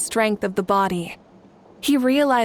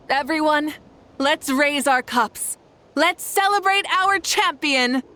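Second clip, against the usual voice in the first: festive toast; 6 semitones higher than usual. Acted. Raising a toast at the feast.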